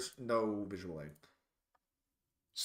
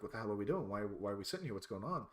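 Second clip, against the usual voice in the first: confused mutter; 5 semitones lower, 9 dB quieter, much faster than usual. Retold or imitated. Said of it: "looking at each other like".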